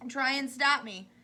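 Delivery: loud, slow defiant retort. A narrator's reading. Missus T V answering Wonka, loud defiant retort. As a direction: dare him to stop you. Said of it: "said Missus T V".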